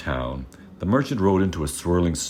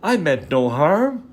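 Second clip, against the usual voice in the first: high, louder begging; cowering plea; 13 semitones higher, 5 dB louder than usual. Acted. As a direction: beg the beast for mercy. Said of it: "The merchant cowered before the beast".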